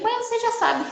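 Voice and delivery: voz de criança leve, mais aguda, de pouco caso; imitando o filho. Retold or imitated. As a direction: imita o filho pequeno respondendo sem vontade. Filho respondendo à mãe. (o filho pequeno respondendo à mãe, imitação aguda e leve)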